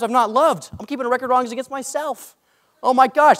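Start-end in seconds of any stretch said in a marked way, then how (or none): 2.8-3.4 loud, high oh my gosh; convicted exclamation. Real, not acted. Pastor overwhelmed by the verses he reads, loud and high. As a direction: blurt it out, overwhelmed. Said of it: "super convicting".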